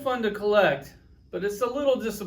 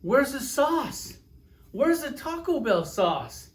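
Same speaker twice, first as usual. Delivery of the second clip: higher, slow incredulous complaint; hammed-up where's the sauce; outraged disbelief; 5 semitones higher, slower than usual. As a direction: drag out the outrage over missing sauce. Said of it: "you're like".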